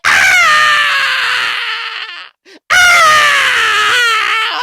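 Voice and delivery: loud high scream of pain; screaming in the dentist chair. Retold or imitated. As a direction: yell like the dentist hit a nerve. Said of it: "my spirit jumped out my body like".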